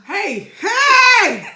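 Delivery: high, loud laughing yell; laughing shout. Real, not acted. Hot wing reaction shout.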